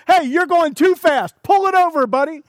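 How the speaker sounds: retold road rage at a fast driver; yelling at drivers. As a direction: scold the speeding car through the windshield. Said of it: "I start yelling at the other cars".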